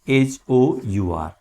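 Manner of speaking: ordinary delivery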